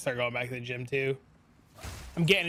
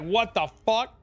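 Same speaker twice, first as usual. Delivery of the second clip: higher, louder mock disbelief; retold shock; 6 semitones higher, 5 dB louder than usual. Telling chat his reaction to his kid, in mock shock. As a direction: replay the stunned reaction, pitched up. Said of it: "I was like".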